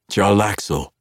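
loud and high; sharp call. Acted. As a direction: snap the name out. Zaknafein snaps name.

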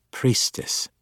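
hushed, icy correction; cold correction. A narrator's reading. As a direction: correct him coldly in a breathy hush. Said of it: "she corrected".

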